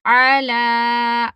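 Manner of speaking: very slow, अला with a long आ on the लाम; अला with a drawn-out ला; long aa demo. Shown as a demonstration. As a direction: stretch the standing zabar in अला. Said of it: "लाम को खरा ज़बर के साथ ही पढ़ना है".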